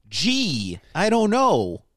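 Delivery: high, put-on Midwest "gee"; a hammed-up Midwest drawl; Midwest accent. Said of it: "Midwest people around that said, like".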